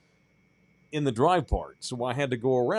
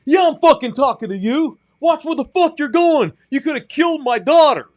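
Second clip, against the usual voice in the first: high, very loud enraged rant; screamed accusation; 14 semitones higher, 10 dB louder than usual. Retold or imitated. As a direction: imitate a furious dad yelling at a driver. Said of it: "this weird guy who screamed at me".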